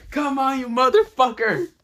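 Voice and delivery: high, loud cursing plea; frustrated yell. Acted. Hero raising his hand for the weapon, frustrated yell. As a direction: goad it on at the top of your voice.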